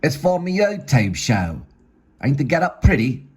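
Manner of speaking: high, broad Cockney voice; Cockney accent. Acted. Gerald defends his costume to Tony, high Cockney accent. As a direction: put on a high-pitched Cockney. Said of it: "In a Cockney accent".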